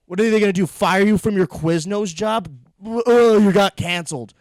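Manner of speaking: loud incredulous taunt; loud mockery. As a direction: shout it down mockingly. Mocking would-be canceled comic.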